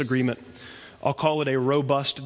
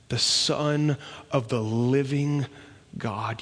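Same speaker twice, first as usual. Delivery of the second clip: usual speaking voice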